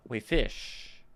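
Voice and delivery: waifish said wuh-FISH; wrong second-syllable stress. Shown as a demonstration. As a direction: throw the weight onto the -ish of waifish. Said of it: "We wouldn't say".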